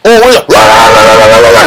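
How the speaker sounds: loud mock rah-rah yelling; shouted crowd noise, rushed; angry neighbors hollering in the night.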